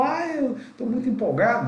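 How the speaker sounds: mock the candidate who is too excited; exaggerated, grinning enthusiasm; overexcited. Shown as a demonstration. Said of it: "cheio de sorrisos".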